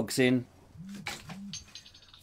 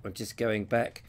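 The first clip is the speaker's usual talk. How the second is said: ordinary talk, nothing marked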